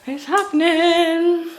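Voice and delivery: high, drawn-out, playful excitement; hyped-up announcement. Pretend. Telling viewers about the hair dyeing, playfully excited.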